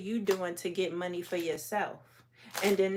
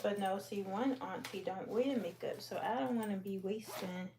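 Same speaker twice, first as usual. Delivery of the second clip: muttering to herself; 12 dB quieter than usual. Real, not acted. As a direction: mumble it under your breath. Muttering to herself while sorting.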